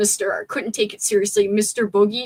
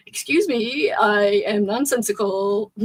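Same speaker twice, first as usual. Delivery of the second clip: ordinary delivery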